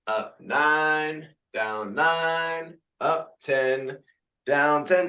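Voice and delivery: upbeat class count, sung out; fitness instructor calls. Acted. Instructor counting reps for the class. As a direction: lead the class through reps.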